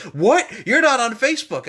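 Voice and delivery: loud, high retold disbelief; mock shock. Host imitating a schoolmate shocked at him, loud incredulous cry. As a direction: imitate a girl's shrill-pitched outburst of surprise.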